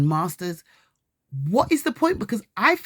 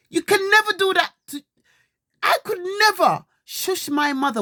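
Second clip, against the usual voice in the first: angry shouting; 13 semitones higher than usual. Real, not acted. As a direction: yell at him in real outrage. Mother shouting at her son.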